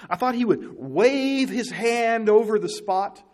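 slow, drawn-out quote; mock pompous Naaman. Preacher voicing Naaman's expectations, slow and lofty. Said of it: "He says".

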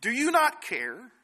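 high, slow, drawn-out plea; pleading plea. Retold or imitated. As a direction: stretch out the question like a hurt plea. Disciples' plea to the sleeping Jesus.

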